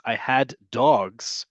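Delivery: "I had dogs" drawn out at half speed; very slow, clear English example; slow model sentence. Shown as a demonstration. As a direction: spell out "I had dogs" slowly for learners. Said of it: "If I want to pluralize".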